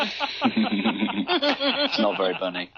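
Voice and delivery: slow, drawn-out line through laughter; mock disapproval while cracking up; laughing. Real, not acted. Host teasing the co-host's rabbit joke, laughing.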